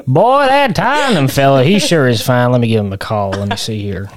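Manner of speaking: mock Panhandle good old boy accent; Florida Panhandle accent. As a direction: play a Southern manager from the Florida Panhandle. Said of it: "a man from the Florida Panhandle".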